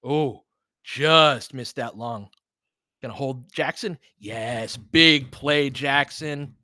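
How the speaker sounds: ordinary talk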